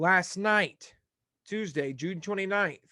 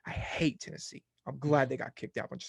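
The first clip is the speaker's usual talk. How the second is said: half-voiced grumble under the breath; muttered aside; 3 semitones lower, 5 dB quieter than usual. Real, not acted. Host mutters his dislike of a rival team under his breath. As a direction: mutter it like a private grudge.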